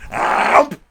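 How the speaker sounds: loud, high gulping chomp; cartoon bear chomp. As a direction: gobble it in one bite. Bear eats the mother.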